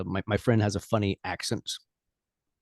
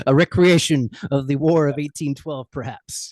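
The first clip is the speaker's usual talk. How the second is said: higher, louder, hammed-up announcer voice; mock-epic announcer; 7 semitones higher, 5 dB louder than usual. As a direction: boom it out like a fight promo, tongue in cheek. Mock-epic framing of British guest.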